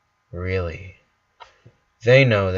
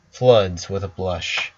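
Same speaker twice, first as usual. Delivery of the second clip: ordinary delivery, nothing marked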